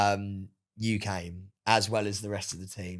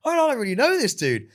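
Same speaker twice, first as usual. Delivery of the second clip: high, loud mock-panicked aside; retold alarm, pitched right up; awkward panic; 13 semitones higher, 7 dB louder than usual. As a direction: squeak out the awkward realisation. Retold awkward inner reaction.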